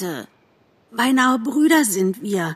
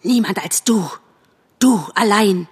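bitter fox accusation; single out the traitor with venom; tense, contemptuous reproach; hissed; 2 semitones higher than usual. A narrator's reading. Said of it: "zischte der Fuchs".

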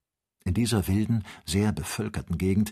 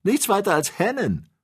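slow, high and louder scoff; mocking; 11 semitones higher, 5 dB louder than usual. A narrator's reading. Joe deriding the ostriches as hens, mocking. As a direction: drag out the jeer at mere hens. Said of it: "höhnte Joe".

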